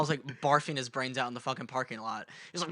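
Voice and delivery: usual speaking voice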